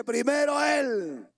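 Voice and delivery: loud, slow "Primero Él" with "Él" hit hard; emphatic shout. Shown as a demonstration. Preacher insisting to the congregation that God comes first, shouting. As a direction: proclaim "Primero Él" slowly, stressing "Él".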